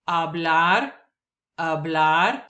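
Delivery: hablar as a-BLAR, silent h; hablar, stress on BLAR; Spanish word. Shown as a demonstration. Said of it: "words that I will pronounce".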